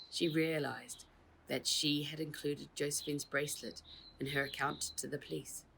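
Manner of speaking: usual speaking voice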